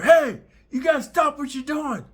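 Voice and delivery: high, loud put-on shout; imitating his own shouted intervention; mock shout. Intervening in the attack.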